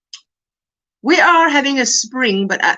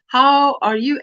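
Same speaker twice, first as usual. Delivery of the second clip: normal talking voice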